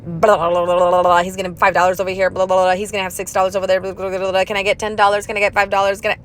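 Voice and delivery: rapid-fire low auctioneer patter; fast auctioneer chant. Retold or imitated. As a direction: rattle it off like an auctioneer at a sale. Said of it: "like those people at the auction".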